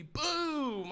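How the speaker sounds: high, punchy boom; cashier's mock firework exclamation. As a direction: pop it like a firecracker.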